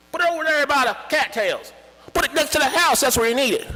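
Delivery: high, loud overlapping shouts; shouting farmers. Retold or imitated. Farmers shouting where to put the pump. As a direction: yell suggestions over each other. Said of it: "everybody starts shouting for where".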